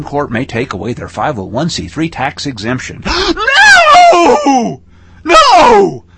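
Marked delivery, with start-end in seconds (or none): 3.1-4.1 sharp, high, loud hammed-up gasp; mock horrified gasp. Mock panic over tax exemption. 4.1-6.0 high, loud mock cry of panic; hammed-up panicked no. Mock panic over tax exemption.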